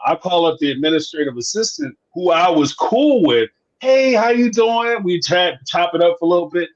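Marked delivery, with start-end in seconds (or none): 3.8-5.0 friendly greeting. Retold or imitated. Friendly call to the assistant.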